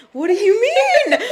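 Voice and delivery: high, loud laughing disbelief; mock shocked question through a laugh; incredulous laugh. Retold stunned reaction to client.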